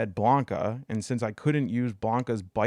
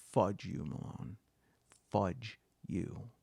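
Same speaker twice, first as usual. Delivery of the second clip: seething curse; 3 semitones lower, 11 dB quieter, slower than usual. Retold or imitated. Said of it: "in a voice that I don't think I'd ever heard her use".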